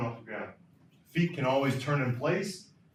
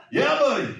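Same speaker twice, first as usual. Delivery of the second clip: much higher, laughing friendly reply; polite Canadian; 14 semitones higher, 9 dB louder than usual. Retold or imitated. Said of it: "polite Canadian".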